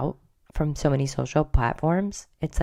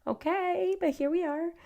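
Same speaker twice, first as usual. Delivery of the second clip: much higher, startled, prim voice; startled reaction; 14 semitones higher than usual. Retold or imitated. Reacting to the [name] video, high startled voice. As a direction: gasp up into a prissy shocked voice. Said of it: "I'm like".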